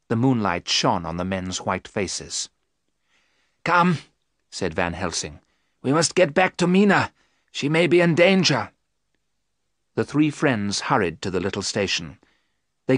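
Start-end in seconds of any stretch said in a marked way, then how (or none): 3.6-4.0 a lightly voiced Van Helsing, higher and louder; urgent old doctor. Van Helsing urging the friends on, lightly voiced. 5.8-8.7 a lightly voiced Van Helsing, higher and louder; urgent old doctor. Van Helsing urging the friends on, lightly voiced.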